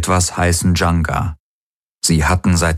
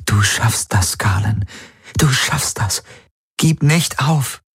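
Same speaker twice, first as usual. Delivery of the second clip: breathy plea climbing higher; pleading; 5 semitones higher than usual. A narrator's reading. Woman begging her friend not to give up, pleading. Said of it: "hielt flehend die Hände zusammen".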